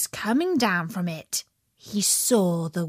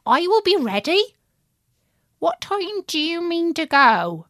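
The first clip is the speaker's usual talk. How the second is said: high, quick little pig; eager piglet chirping back; 9 semitones higher, 4 dB louder than usual. Acted. Pig answers the wolf.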